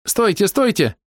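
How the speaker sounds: high squeaky female cry; high-pitched urgent squeal; squeaked plea. A narrator's reading. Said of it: "пропищала берёза".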